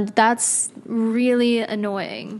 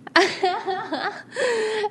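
real high-pitched giggling; uncontrolled giggle fit; 11 semitones higher, 4 dB quieter than usual. Real, not acted. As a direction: burst into helpless high giggles. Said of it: "the laughs real bad".

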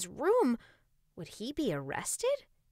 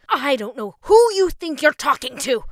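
loud furious mom shout; angry yelling; 6 semitones higher, 18 dB louder than usual. A narrator's reading. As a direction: yell it at the kid. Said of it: "his mother snapped back".

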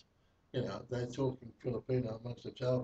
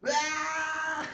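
imitated scream; 13 semitones higher than usual. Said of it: "let out a massive scream".

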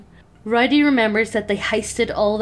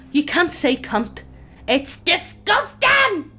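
scolding; 9 semitones higher, slower than usual. Acted. Scolding the child.

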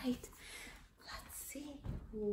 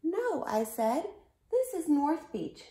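put-on higher, louder child character; matter-of-fact storybook kid; boy hero replying; 7 semitones higher, 10 dB louder than usual. A narrator's reading. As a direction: pipe up to correct the pirate. Reads boy narrator's reply.

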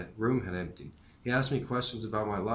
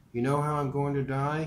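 lightly voiced old professor, higher and earnest; 5 semitones higher, 5 dB louder than usual. A narrator's reading. Dying professor explaining life to his student, lightly voiced. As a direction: speak as the dying teacher, steady and open. Maury asks Mitch.

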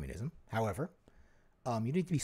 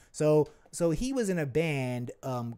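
usual speaking voice

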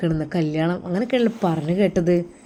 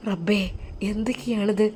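faint murmur; 7 dB quieter than usual. Acted. Mother faint.

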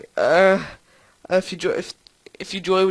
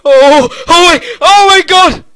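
loud, high, drawn-out cry of alarm; alarmed yell; 7 semitones higher, 10 dB louder than usual. Real, not acted. Pilot losing control of the helicopter, alarmed yell. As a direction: build from a long oh to a high yell.

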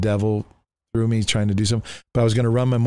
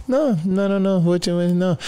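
high, repeated mock-innocent protest; lying denial; 8 semitones higher than usual. Covering up to his old girlfriend, lying denial. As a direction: deny it all too eagerly. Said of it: "some so-called Christians could lie".

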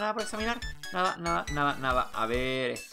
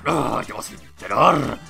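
burla enfadada; 9 dB louder, slower than usual. Acted. Owner scoffing loudly.

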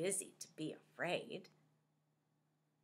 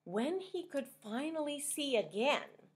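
plain reading voice

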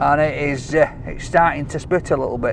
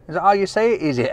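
ordinary talk, nothing marked

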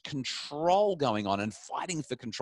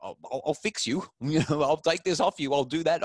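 louder, higher mock scolding; hammed-up angry dad; mock threatening parent; 6 semitones higher, 4 dB louder than usual. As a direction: parody a parent making threats. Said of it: "the typical response is".

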